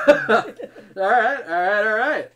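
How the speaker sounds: loud laughing all right; laughing through the words. Real, not acted. Host laughing at answer.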